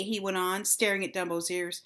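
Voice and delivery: usual speaking voice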